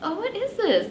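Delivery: high, puzzled disbelief; confused. Retold or imitated. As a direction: sound baffled by a check. Puzzled by a surprise check.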